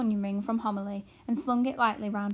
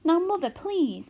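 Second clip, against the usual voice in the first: begging; 8 semitones higher, 7 dB louder than usual. A narrator's reading. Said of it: "begged Arrietty".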